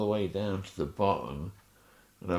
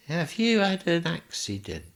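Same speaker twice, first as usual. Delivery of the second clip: much higher, playing the joking friend; imitating Joe; 12 semitones higher than usual. Imitating his friend Joe popping through the curtains. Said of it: "He goes".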